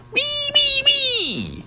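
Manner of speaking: loud, piercing triple "wee"; all-out high toy-ambulance sound; hard, high-pitched noise. A narrator's reading. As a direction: squeal hard and fast like a siren. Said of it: "hard and fast and high-pitched".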